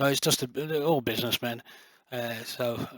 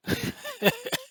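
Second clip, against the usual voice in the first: high laugh. Real, not acted. Laughs at own joke.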